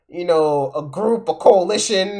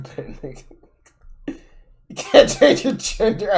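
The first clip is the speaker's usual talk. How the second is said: usual speaking voice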